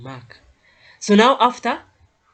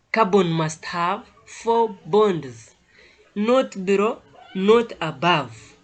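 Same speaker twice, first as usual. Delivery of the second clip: slow and louder, stressing "Carbon" and "four bonds"; slow emphatic stress; 2 semitones higher, 5 dB louder, slower than usual. Shown as a demonstration. Teacher insisting to students on the bond rule, slow and loud. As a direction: hammer home "Carbon must have four bonds" word by word. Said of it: "I will always emphasize this".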